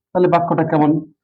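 ordinary talk, nothing marked

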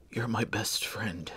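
soft, slow, smiling words; tender whisper. Acted. Dying Blair to Benjamin, softly and slowly. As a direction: say it gently with a smile. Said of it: "he said with a smile".

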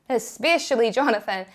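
usual speaking voice

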